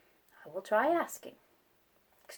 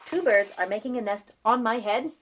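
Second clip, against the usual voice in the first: ordinary delivery, nothing marked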